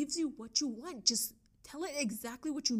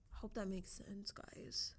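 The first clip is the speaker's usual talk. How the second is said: soft whispered aside; 8 dB quieter, slower than usual. Real, not acted. Vlogger checking viewers understood, whispered. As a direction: breathe it out as a whisper.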